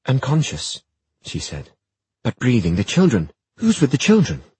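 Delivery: urgent, rising to high alarm. Acted. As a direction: fear building for the children. Cecily finds the maid.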